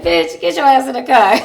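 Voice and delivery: exasperated retold shout; mock angry order. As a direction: shout it like a fed-up cop. Retold cop orders her into car.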